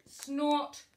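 a loud snorted word. Acted. The big thing answering the baby bird, a loud snort. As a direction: snort it like a machine.

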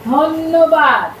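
ধন্যবাদ stretched slow and loud. Shown as a demonstration. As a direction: drag out ধন্যবাদ loudly, syllable by syllable. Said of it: "কি বললাম বলো তো?".

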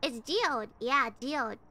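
"geode" in two syllables, JEE-ode; correcting pronunciation. Shown as a demonstration. Friend confirms how it's pronounced, "geode" as JEE-ode. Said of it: "is it really pronounced as geode".

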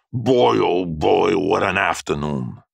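slow, drawn-out delight; long, savouring exclamation; thrilled exclamation. A narrator's reading. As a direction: stretch every word, reliving a great time. Thrilled man back from bike ride.

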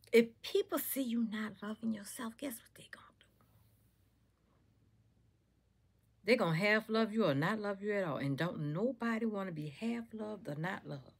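hushed confiding whisper; breathy secret aside. Real, not acted. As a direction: lean in and whisper it.

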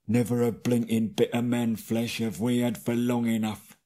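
grumbling troll wanting man flesh; mild troll gripe; second troll. A narrator's reading. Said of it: "said a second".

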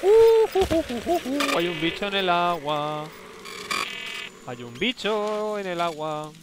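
slow, high ghostly moaning; spooky ghost moan. Pretend. Streamer spooking himself about a creature in the water, slow ghostly moan.

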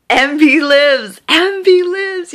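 high, drawn-out jubilant exclamation; overjoyed cry. Real, not acted. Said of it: "I'm beyond happy".

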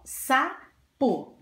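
"sapo" as two separated beats, sa... po; syllable by syllable. Shown as a demonstration. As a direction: split "sapo" with a short pause. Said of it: "Duas sílabas".

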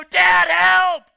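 high, full shout to dad, long-held plea; crying out for help. Acted. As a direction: yell like a child urgently calling a parent. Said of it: "when they're crying out, they go".

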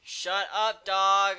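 furious yell at full volume, pitch shooting up; angry shout. Real, not acted. Yelling at the barking dog to shut up, furious shout. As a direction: bark the order at the dog, really angry.

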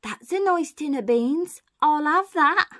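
high, slow female-burglar voice; lady thief admiring her haul; mystery burglar. Acted. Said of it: "The voice says".

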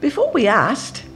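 put-on high, eager young woman's voice; bubbling with discovery; excited Betsy. A narrator's reading. Betsy urges her sister to reread, excited.

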